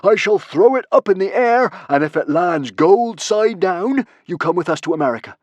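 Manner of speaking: loud, high declaration building to a screech; ranting madman working up a crowd; villainous yell. Acted. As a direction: proclaim the rules slowly, ending in a drawn-out yell. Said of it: "yelled Norbert the Nutjob".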